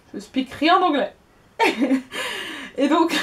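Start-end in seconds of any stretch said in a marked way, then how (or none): 1.6-2.7 quick amused laugh; a light real laugh; short laugh. Real, not acted. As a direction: laugh at her own joke. Laughs at her own joke.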